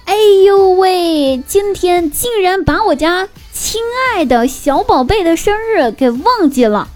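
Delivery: doting mom. Retold or imitated. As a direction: drawl it out like a fussing mom. Mom's doting post read aloud.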